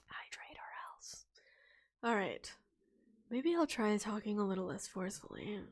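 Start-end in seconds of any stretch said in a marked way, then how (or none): 0.1-1.3 menacing whisper. Pretend. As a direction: whisper it like a threat. Whispered threat to audience.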